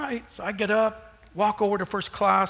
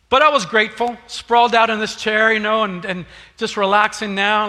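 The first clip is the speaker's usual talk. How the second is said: big, loud and high, building the story; booming pulpit voice over the congregation; preaching loud; 4 semitones higher, 8 dB louder than usual. Real, not acted. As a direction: lean into it with full preaching force.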